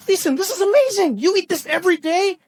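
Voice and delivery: very high, astonished exclamation; incredulous high-pitched delight; amazed. Retold or imitated. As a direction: gush in hammed-up amazement. Acting amazed first taste of curry.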